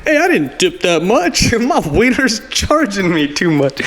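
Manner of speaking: high, put-upon complaining voice; whiny customer. Pretend. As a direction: complain like a cheated diner.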